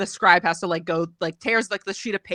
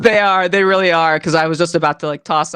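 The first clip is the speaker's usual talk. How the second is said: emphatic admission; 6 semitones lower, 9 dB louder than usual. Real, not acted.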